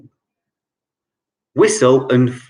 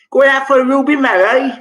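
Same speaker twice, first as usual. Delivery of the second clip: high, quick Cockney line; a lightly put-on Cockney pub question; Cockney accent; 11 semitones higher, 3 dB louder, faster than usual. Shown as a demonstration. Quizmaster showing slang to players, Cockney accent. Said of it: "I will try and do a Cockney accent".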